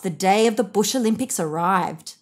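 plain reading voice

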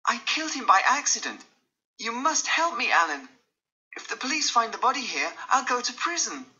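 high, frantic pleading; desperate. Acted. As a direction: plead for help, panicking. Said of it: "Dorian answered desperately".

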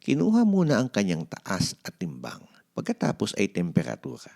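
ordinary delivery

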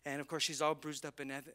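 normal talking voice

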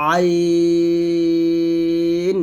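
আইন squeezed in mid-throat, held very long; pressed thin demo. Shown as a demonstration. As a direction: press and stretch আইন on a level note. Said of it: "আইনটা চাপ দিবেন". (চাপ দিয়ে চিকন আইন)

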